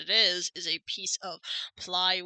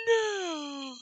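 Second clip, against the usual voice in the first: mock shout; 6 semitones higher than usual. Defiant character shouting refusal.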